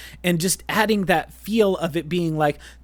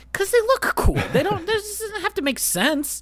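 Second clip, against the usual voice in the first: very high, squeaky indignant protest; excited high-pitched retort. Real, not acted. Fan answering a mock question about ship shapes, high and exasperated. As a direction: shoot up high in playful exasperation.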